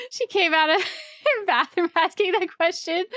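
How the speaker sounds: talking through laughter; amused, giggly retelling; laughing. Real, not acted. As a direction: laugh while telling it, voice a bit high. Amused at her own story.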